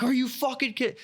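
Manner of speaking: high-pitched mock outrage; hammed-up incredulous dad; imitating dad freaking out. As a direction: freak out like an exasperated dad, voice shooting up. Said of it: "the dad's freaking out".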